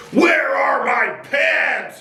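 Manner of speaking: slow, high-pitched bellow; giant's roar. A narrator's reading. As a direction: roar it out, stretching every word. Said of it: "he roared".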